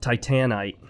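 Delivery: "Titanite" on its own as tie-TAN-ite; "Titanite" with a full, stressed middle syllable; proposed pronunciation. Shown as a demonstration. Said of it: "I do actually think that the proper pronunciation".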